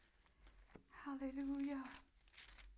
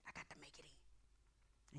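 whispered worry; 7 semitones lower, 23 dB quieter than usual. Retold or imitated. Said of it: "they say things like".

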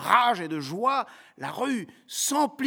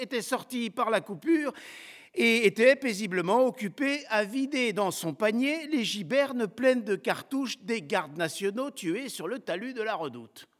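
usual speaking voice

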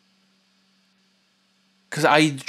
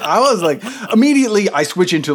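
usual speaking voice

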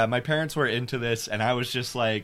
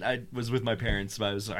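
ordinary talk, nothing marked

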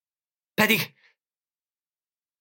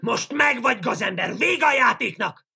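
triumphant shout; 11 semitones higher than usual. Acted. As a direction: crow over the caught villain. Triumphant capture after the roar.

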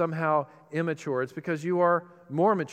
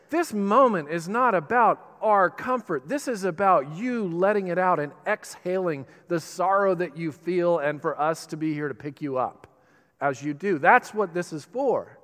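louder, rising preacher's push; full-force preaching; 3 semitones higher, 5 dB louder than usual. Acted. Pastor urging the church to let mourners grieve, forceful. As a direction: drive the point home from the pulpit.

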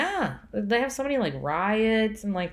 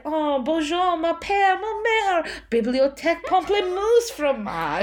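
higher, louder, hammed-up fake French; 8 semitones higher, 4 dB louder than usual. Teasing her brother with a burst of fake French. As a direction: rattle off French words like a show-off. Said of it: "I'd be like".

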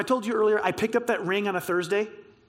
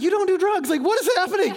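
high-pitched mock panic of a baffled friend; freaking out; 7 semitones higher, faster than usual. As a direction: act out a bewildered freak-out. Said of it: "freaking out".

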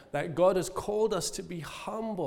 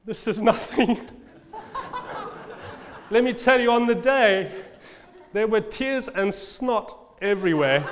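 chuckling through the words, higher and louder; laughing; 5 semitones higher, 4 dB louder than usual. Real, not acted. Pastor to congregation about crying at his wedding, laughing. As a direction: laugh as you admit you fell apart.

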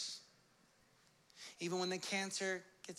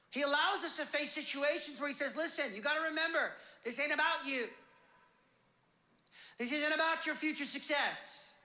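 raised, driving pulpit cadence; full-force preaching; 9 semitones higher, 3 dB louder than usual. Real, not acted. Preacher tells believers God is testing them, impassioned. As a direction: build with urgent, emphatic phrases.

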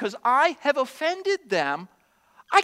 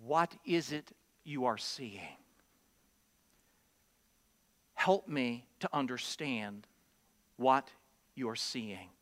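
soft, low, slow caring question; gentle asking; 12 semitones lower, 9 dB quieter, slower than usual. Shown as a demonstration. Believer gently asking a brother what he sees. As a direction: ask tenderly, really wanting to understand. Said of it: "God wants you to say".

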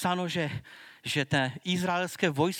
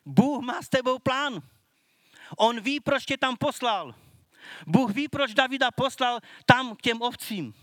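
fervent sermon climax, higher and louder; preaching at full force; 4 semitones higher, 3 dB louder than usual. Acted. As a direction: proclaim it to the congregation.

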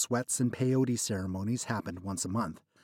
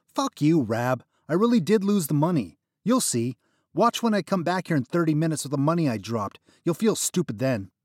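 higher, louder angry outburst; angry retort; 6 semitones higher, 6 dB louder than usual. Acted. Narrator yelling at the dealer who refused him. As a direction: snap back at him, furious and loud.

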